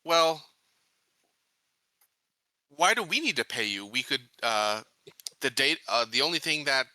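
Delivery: normal talking voice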